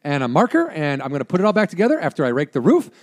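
hammed-up meth head rattling off plans; mock meth head. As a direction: imitate a wired obsessive, starting high. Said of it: "that is very crystal meth behavior".

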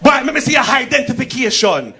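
put-on high, heavily accented patois demand; Jamaican policeman. Policeman clarifying ID to tourist, thick Jamaican accent. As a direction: stretch it out as an exasperated Jamaican officer. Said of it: "One policeman come out of the bush".

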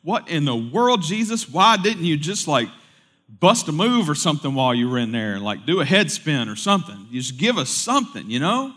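playful high incredulous complaint; mock exasperation at Jesus. Pastor voicing his own complaint to Jesus, mock exasperated. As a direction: needle him like a cheeky friend. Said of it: "and go".

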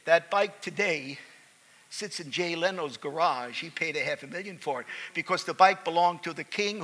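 normal talking voice

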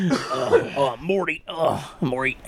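laughing Rick voice with burps; breaking up while doing Rick; Rick impression. Imitating Rick from Rick and Morty.

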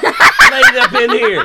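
loud, high mock tirade; re-enacted shouting. Dad retelling his own outburst, shouting. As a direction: bellow it as a comic accusation.

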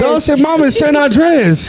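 mock kid voice. Mocking the kid's answer.

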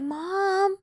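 whiny daughter. Retold or imitated. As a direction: drag it out like an exasperated kid. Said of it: "She's like".

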